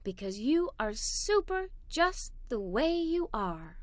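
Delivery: a mother's soft bedtime words to her daughter; tender mother. A narrator's reading. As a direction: reassure her gently at bedtime. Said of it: "my mother said as she kissed me".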